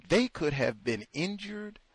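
ordinary talk, nothing marked

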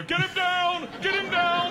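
high, loud repeated command; urgent shouting. Retold or imitated. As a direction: holler orders mid-struggle. Sound guy yelling in struggle.

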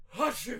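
high, loud "achoo" burst; mock sneeze. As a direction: imitate an explosive cartoon sneeze. Imitates sneeze missile heard far off.

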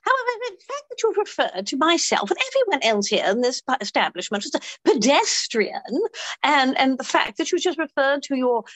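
hammed-up British indignation, slowing at the end; London accent. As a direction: object haughtily in a London accent. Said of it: "I'll do the London accent".

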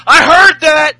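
mock shout. Owens shouting back at Jericho, mock shout. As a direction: bark it across the room. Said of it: "Owens says".

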